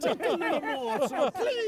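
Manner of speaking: begging orphan. Pretend. Oliver Twist begging quote.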